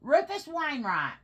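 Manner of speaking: high, loud call falling at the end; calling the dog. Real, not acted. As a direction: summon your pet by full name.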